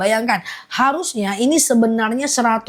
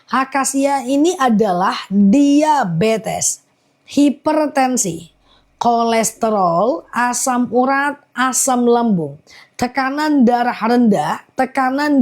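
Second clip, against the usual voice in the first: slow, spaced-out list reading; slow down so every word lands; measured, deliberate pace; slowly repeated. Shown as a demonstration. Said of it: "aku ulang pelan-pelan ya".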